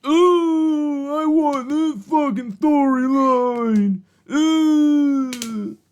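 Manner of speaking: mock whiny fan. Mocking fans who want this storyline.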